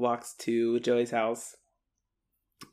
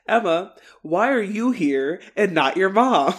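louder, higher confronting question; mock exasperated demand; 5 semitones higher, 6 dB louder than usual. Snake confronting Emma at the door, mock exasperated demand. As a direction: play up the indignant questioning.

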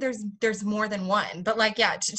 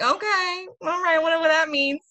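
much higher-pitched, doubtful going-along; baffled acceptance; 7 semitones higher, 4 dB louder than usual. Retold or imitated. American retells her baffled reply, pitched high.

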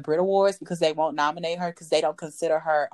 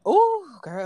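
excited gossip; 10 semitones higher, 5 dB louder than usual. Real, not acted. Gossip exclamation.

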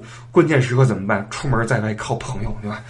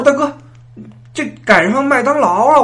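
high, loud cry of disbelief; shocked protest; 8 dB louder than usual. Acted. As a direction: wail at the vendor over the price. Shocked at the price. (又高又响地惊呼抗议)

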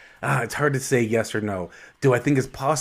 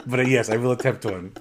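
ordinary talk

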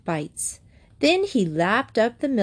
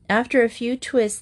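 ordinary delivery

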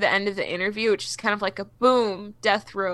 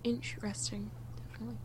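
whispered; 20 dB quieter, faster than usual. Real, not acted. Hushed aside.

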